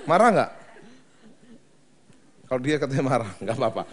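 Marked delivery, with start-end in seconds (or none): 0.1-0.5 louder, pointed question; louder challenge. Shown as a demonstration. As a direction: push the question at them louder. (bertanya lebih keras)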